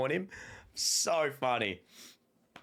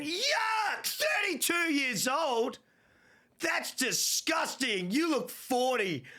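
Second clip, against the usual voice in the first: mock disgusted woman; 11 semitones higher, much slower than usual. Retelling the gym woman mocking his age, slow high disgust. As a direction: sneer it in a shrill mock voice. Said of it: "She went".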